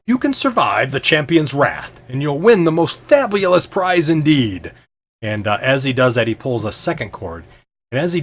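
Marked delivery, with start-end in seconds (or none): 0.0-4.7 slow, high showman's announcement; ringmaster's drawn-out, raised-voice proclamation; loud proclamation. Acted. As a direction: announce the prize grandly and slowly. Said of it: "kinda loudly".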